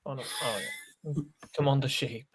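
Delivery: normal talking voice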